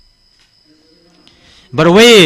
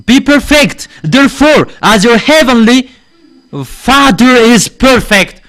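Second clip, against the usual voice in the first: scripture reading; 4 semitones higher than usual. Shown as a demonstration. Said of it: "reading scripture aloud".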